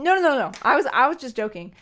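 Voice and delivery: real flustered denial to chat; hasty denial. Real, not acted. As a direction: quickly backpedal on the joke. Backpedalling on a joke to chat.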